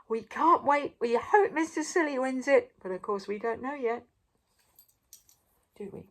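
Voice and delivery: usual speaking voice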